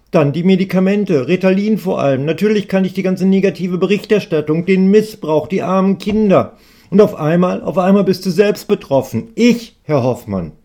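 a talkative customer pouring out his worries; young student voice. Acted.

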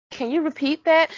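high, loud what did you just say; stunned question. Pretend. Fan stopping mid-battle to question Hank, loud and incredulous. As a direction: stop everything in disbelief. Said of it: "Just turns and look down".

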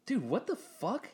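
high, startled what the fuck; incredulous outburst. Retold or imitated. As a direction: pitch jumps up in disbelief. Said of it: "I'm like".

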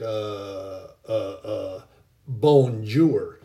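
hesitant grunts, then a clumsy loud bonjour in a bad French accent; the big guy fumbles a fake French bonjour in a bad French accent; butchered French accent. The Sasquatch-like man exposed as a fake French model, fumbling a bad French accent. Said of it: "Totally butchers the French language".

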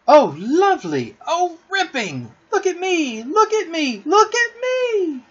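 put-on very high, squealing child voices; gleeful children. A narrator's reading. Children flying round the nursery, gleeful high-pitched squeals. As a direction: squeal with delight, stretching the words out.

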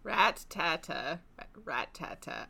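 slow Rat-tata, pause after Rat; slow attempt. Shown as a demonstration. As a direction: try Rat-tata with a break after the first syllable. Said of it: "put the emphasis on that part".

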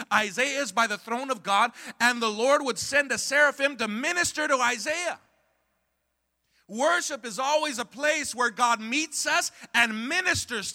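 high, loud preacher crying out to the church; preaching at full force. Acted. Pastor driving home worship to the congregation, full-force preaching. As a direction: preach it with rising fire.

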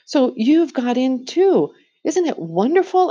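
a lightly voiced girl thrilled to see her brother; excited girl. A narrator's reading. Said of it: "too happy and excited".

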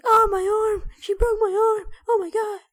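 very high squealed falsetto wailing; hammed-up panicked squeal of pain; mock agony. Acting out bully with broken arm, mock agony.

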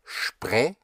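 "spre" opening with a sh sound; sp as shp. Shown as a demonstration. Teacher shows the first syllable, "spre" said as shpre. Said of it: "is like an S H P".

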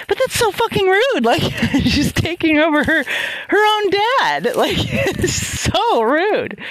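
high, drawn-out outrage with laughter; indignant protest. Retold or imitated. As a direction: laugh through a hammed-up, high-pitched protest. Retold indignant protest to friend.

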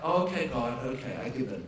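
low quiet giving in to God. Retold or imitated. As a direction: sigh it out, defeated and hushed. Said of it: "I'm like".

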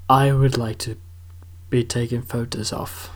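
a light mock British accent on a would-be model; an aspiring model asking politely, in a hint of British. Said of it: "I don't know why it was British".

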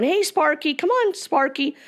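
acted calling-over voice; calling the dog. Owner calls his dog in joke.

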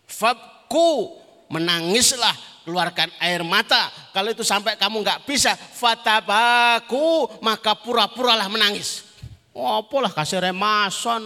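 high-energy religious oratory; forceful preaching. Real, not acted. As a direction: preach it out to the whole crowd.

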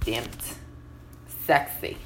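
ordinary delivery

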